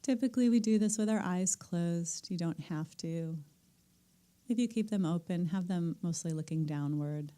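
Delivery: calm meditation teacher settling the room; guided meditation. Acted. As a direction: ease the group gently into stillness. Leads the group into meditation.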